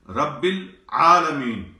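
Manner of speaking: العالمین said slowly with a clear throaty ain after il, no lam; teacher demo of العالمین with ain, not lam. Shown as a demonstration. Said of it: "یہاں عین ہے، لام نہیں ہے".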